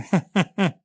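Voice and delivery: high, loud acted laugh; mocking laugh. A narrator's reading. Conductor laughing at the abandoned wife, mocking. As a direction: burst into a jeering laugh. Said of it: "Il conducente si mise a ridere".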